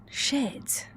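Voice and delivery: unvoiced whispered swear; whispered curse. Real, not acted. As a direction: breathe it out like a slip.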